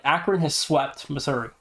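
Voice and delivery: usual speaking voice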